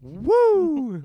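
high, loud mock crowd whoop; wrestling crowd cheer. Co-host to host, hammed-up crowd whoop.

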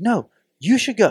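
high, loud mock-argument voice; insistent retort. Imitating a woman arguing over who goes, high insistent retort.

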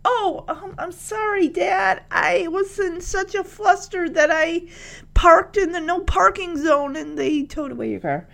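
high, apologetic, flustered; sheepish daughter voice. Pretend. Voicing Becky apologetic.

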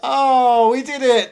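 high, loud celebratory cheer; over-the-top triumphant shout; mock triumph. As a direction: crow it like a hammed-up victory.

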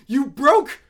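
high, slow, loud outrage; furious parent. Retold or imitated. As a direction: shout it slowly, appalled. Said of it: "His parents are like".

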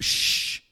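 a quick whispered shh to silence the crowd; hushing shh. Shown as a demonstration. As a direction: hush them like a theater crowd. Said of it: "they would have hushed".

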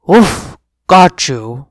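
lightly voiced playful dog; dog barking. Dog pins the kitten, mock barking voice. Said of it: "a dog barked".

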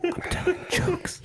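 high, slow squeaky mimicry; mocking imitation. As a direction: parrot him in a drawn-out mock falsetto. Mocking the other man's excuse.

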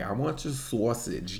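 lightly mocked New Jersey accent; a hint of put-on Jersey. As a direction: imitate a Jersey girl's vowels. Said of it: "I want the New Jersey accent".

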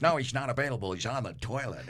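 mock announcer voice on the phone; radio guy voice. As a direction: play the gruff radio character brushing off a caller. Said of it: "I'm like".

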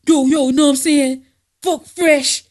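[name]. Retold or imitated. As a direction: mimic his indignant call-out loudly. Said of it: "[name] read it and got mad".

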